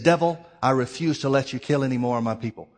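loud, firm rebuke of the devil; retold forceful defiance; defiant refusal. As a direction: stand up and refuse him outright. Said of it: "slammed his hand on the desk".

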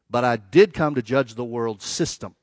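"did" punched, slow deliberate line; stress on DID. Retold or imitated. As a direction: land hard on "did" in contrast. Contrasts didn't with did.

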